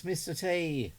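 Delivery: ordinary talk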